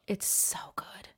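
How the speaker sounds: whispered aside, slow. Real, not acted. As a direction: breathe it like a secret tip. Confiding aside about Montreal.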